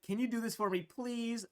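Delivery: high, whiny begging voice; mock pleading. Pushy stranger begging her for a favor, high and whiny. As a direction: imitate a needy stranger asking a favor. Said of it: "but it's like".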